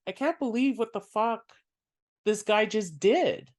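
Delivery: normal talking voice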